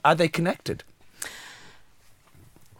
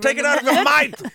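mock cockney shout; 7 dB louder than usual.

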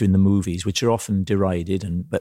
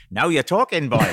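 much higher gleeful Welsh, laughing; Welsh piano teacher; 6 semitones higher than usual. Retold or imitated. Welsh teacher delighted by the pupil's price, high Welsh voice with laughter. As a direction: pounce on the offer in high Welsh. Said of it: "and Dud goes".

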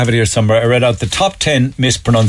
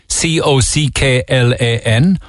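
normal talking voice

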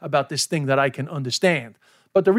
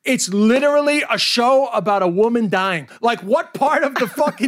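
raised, incredulous comic rant; high-pitched acted exasperation; exasperated retort; 6 semitones higher, 3 dB louder than usual. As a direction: argue back at the complainer in mock outrage. Acted exasperated reply to the complainer.

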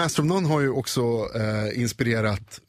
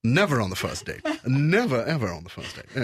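demure mock prude declining in English; chaste; 2 semitones lower than usual. Shown as a demonstration. Chaste character turns down a date, demure refusal. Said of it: "Kysk, då är man ju mer".

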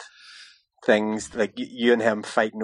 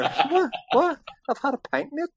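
high, squeaky mock outrage; mock indignant protest. Mimicking the hockey player's outraged reply at the bar, high and squeaky. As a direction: play the wronged big man, pitched way up.

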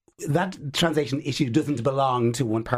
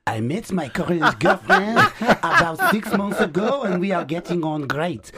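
slow, higher, laughing send-up of the letter; mock letter writer; 4 semitones higher, 4 dB louder than usual. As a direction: read it out like a smug tool, slow and amused. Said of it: "read the letter like this and go".

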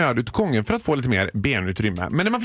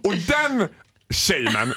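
ordinary talk

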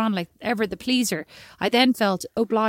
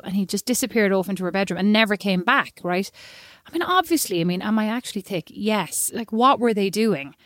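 ordinary talk, nothing marked

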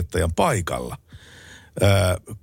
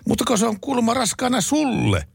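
high mock outraged wife, pitched way up; wife shouting. Wife confronting her husband, high mock shout. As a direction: squeal it as the furious wife. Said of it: "huutaa emäntä".

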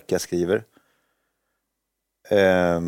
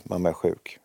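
ordinary talk, nothing marked

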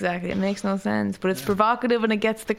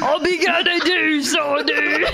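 mock shout; 8 semitones higher, 4 dB louder, slower than usual. Shouted laughing reply in character.

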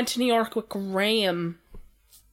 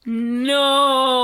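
long, drawn-out disbelief; appalled, amused refusal; incredulous; 4 dB louder than usual. Real, not acted. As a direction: stretch it out in disbelief. Reacting to ridiculous pet word.

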